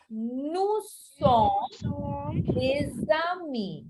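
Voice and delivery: very slow, stretched out, fading on amis; slow French model. Shown as a demonstration. Teacher modelling French for students, very slow and drawn out. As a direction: draw it out word by word for the class. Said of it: "I will say".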